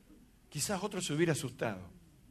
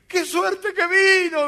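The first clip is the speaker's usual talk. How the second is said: much higher, loud slow sobbing cry; crying shout; 13 semitones higher, 10 dB louder, much slower than usual. Retold or imitated. Man on the roof crying out to the arriving pastor, loud sobbing shout. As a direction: wail it out with relief.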